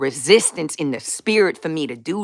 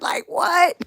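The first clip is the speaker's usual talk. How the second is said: much higher cry of what; high disbelief; 12 semitones higher than usual. Real, not acted. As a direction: shriek it in mock shock. Reacting to her own prayer.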